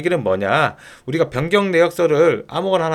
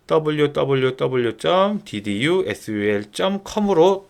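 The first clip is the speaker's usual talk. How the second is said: slow spelled-out address; slower than usual. Shown as a demonstration. Dictating the website address.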